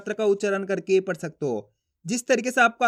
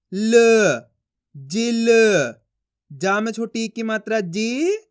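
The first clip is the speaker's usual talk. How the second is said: slow, syllable by syllable, each sound separated; teacher sounding out letters for young pupils; phonics drill; slower than usual. Shown as a demonstration. Teacher reads Hindi syllables to class, slow and deliberate.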